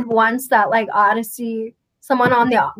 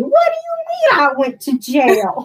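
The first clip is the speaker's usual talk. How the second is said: higher put-on voice, mock protest; Rodney; 4 semitones higher, 3 dB louder than usual. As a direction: mimic an indignant cartoonish protest. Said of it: "You sounded like Rodney".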